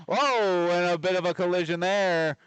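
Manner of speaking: excited call. Real, not acted. Commentator calling a sudden collision on the ice, excited. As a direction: jump on the play as it happens.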